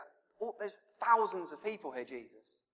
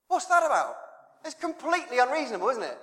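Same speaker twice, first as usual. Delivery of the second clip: incredulous; 9 semitones higher, 4 dB louder, faster than usual. Real, not acted. Preacher's exclamation on the story.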